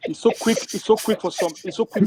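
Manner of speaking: words through laughter; laughing while talking. Real, not acted. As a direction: talk while still laughing. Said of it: "He's laughing".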